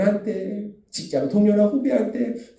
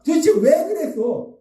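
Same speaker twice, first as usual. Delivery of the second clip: higher, drawn-out mock reproach; condemning rebuke; 8 semitones higher than usual. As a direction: act out judging someone, slow and high. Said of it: "비판하고 정죄하더라는". (비판하고 정죄하듯 높고 길게 따지는 말투)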